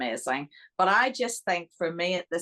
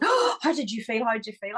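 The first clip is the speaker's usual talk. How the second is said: higher, gasping eager questions; breathless mock excitement, pitched up; eager questioning; 6 semitones higher than usual. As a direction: imitate an excited sister wanting to know. Retells sister's eager phone questions.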